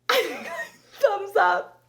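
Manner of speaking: high squealing laugh over the words. Retold or imitated. Posing for group photo.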